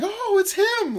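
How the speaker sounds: high-pitched fake gushing; mock over-excitement. Mocking anyone thrilled to see him, exaggeratedly excited. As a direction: squeal it like a starstruck fan, hammed up. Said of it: "no one's that excited to see me".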